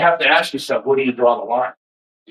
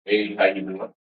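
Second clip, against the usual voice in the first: softer and lower, easy hello; soft friendly greeting; 6 semitones lower, 7 dB quieter than usual. Shown as a demonstration. Showing viewers his softer side, quiet and easy. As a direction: drop it down to a gentle, calm greeting. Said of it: "there are times that I speak very".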